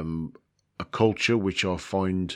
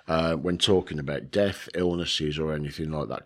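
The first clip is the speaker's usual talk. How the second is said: ordinary delivery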